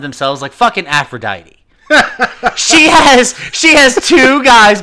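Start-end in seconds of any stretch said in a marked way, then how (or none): 1.9-4.8 laughing. Real, not acted. Laughing about Aphrodite.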